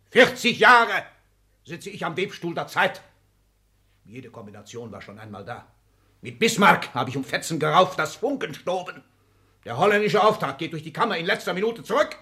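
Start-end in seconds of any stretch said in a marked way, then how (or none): 0.1-3.0 loud, high proclamation; proud boast. Acted. Exzellenz boasts of forty years to his secretary, loud and high. 6.2-12.2 loud, high, fast surge; fierce vow. Acted. Exzellenz vows to his secretary, fast, loud and high.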